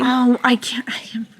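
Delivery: breathy whisper, holding back. Real, not acted. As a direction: whisper it, can't say it. Refusing to describe it.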